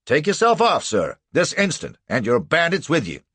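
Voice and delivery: loud, high commanding judge; pompous judge dismissing; indignant order. A narrator's reading. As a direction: order them out.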